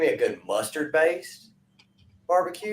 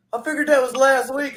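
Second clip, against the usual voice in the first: much higher-pitched mock protest; protesting customer; 11 semitones higher, 4 dB louder than usual. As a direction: imitate a customer pleading his case, pitch way up. Customer's acted protest in retold quarrel.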